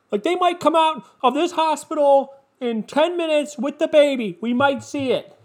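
high-pitched, hammed-up royal-watcher hype; mock breathless excitement. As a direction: announce it like a thrilling live event, mockingly.

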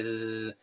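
low steady zzz; fly buzz. Shown as a demonstration. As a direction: imitate a fly buzzing. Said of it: "Una mosca hace". (zumbido de mosca)

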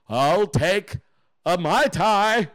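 drawn-out, put-on ordering voice; mock drink order. The man in the back orders his cocktail, drawn-out mock voice. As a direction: stretch out the drink name in a put-on voice.

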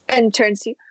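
ordinary delivery

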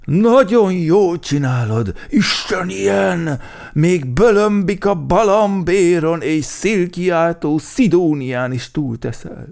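high, loud, drawn-out gushing; Medve Medárd praising. A narrator's reading. Bear soothing the offended crow, loudly gushing praise. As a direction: heap on loud, slow, exaggerated praise. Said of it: "de Medve Medárd közbeszólt".

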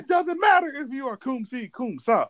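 high, insistent mock wrestler promo; hammed-up wrestling trash talk, pitched high; The Rock. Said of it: "he said".

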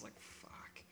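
whispered curse. Real, not acted. Reacting to a creepy buyer.

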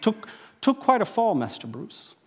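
a hint of an English butler, gently teasing; English butler accent. Alfred to young Bruce in a light English butler accent. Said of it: "that English accent".

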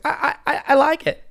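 high, stammering mock of the driver; defensive justifying voice. Imitating a man justifying himself to others, high defensive voice. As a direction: stammer it way up high, trying to look cool. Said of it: "when you say things in that voice".

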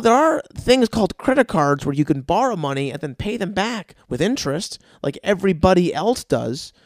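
usual speaking voice

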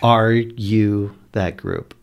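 loud, slow projected question; non-alarming but audible. Acted. Player questioning strangers from up a tree, loud measured call. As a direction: call it down word by word. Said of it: "so I'm gonna say".

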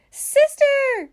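high, loud call for sister; acted alarmed yell; frightened shout. A narrator's reading. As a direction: call out in panic. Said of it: "shouted Baby".

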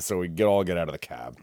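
ordinary delivery

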